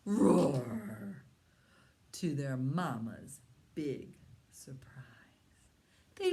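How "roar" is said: big loud roar; dragon roar. Pretend. Parent leads child in a loud dragon roar.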